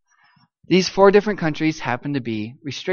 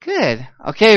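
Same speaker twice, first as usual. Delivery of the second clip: normal talking voice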